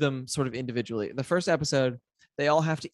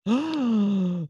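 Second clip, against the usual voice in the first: excited gasp; 7 semitones higher than usual. Real, not acted. Reacts to a spooky book.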